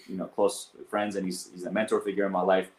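ordinary talk, nothing marked

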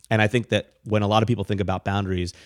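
normal talking voice

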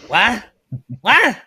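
high, loud, bewildered cry; baffled outburst. Acted. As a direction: blurt it out in utter confusion. Baffled character interrupts the naming joke.

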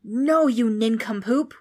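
loud, drawn-out ghostly wail; exasperated wail. A narrator's reading. Ghost correcting a foolish boy, exasperated wail. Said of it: "wailed the ghost".

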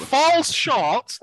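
slow, high, stressing falls; slow emphatic. Acted. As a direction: drag out falls short firmly. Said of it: "Let me finish".

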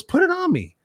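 imitating Les, high and insistent; insisting. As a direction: voice jumps way up, commanding playfully. Les insists bandana goes on him.

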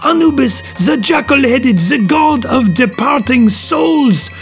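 put-on high, alarmed accented voice, slowing at the end; awestruck Poirot. A narrator's reading. Detective naming the apparition, high and alarmed. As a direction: proclaim the god in high-pitched dread. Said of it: "crossing himself vigorously".

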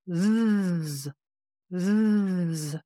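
a long steady voiced zzz buzz; bee-like buzzing zzz. Shown as a demonstration. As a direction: hold a buzzing Z sound from the belly. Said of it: "Make a Z sound, a bit like a buzz".

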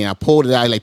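ordinary talk

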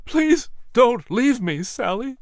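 high, slow plea, fading at the end; desperate pleading. A narrator's reading. Dying wife, husband pleads.

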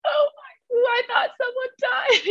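high, teary shout of relief; relieved outcry. Retold or imitated. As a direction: cry out in disbelief and relief. Relief after fearing a death.

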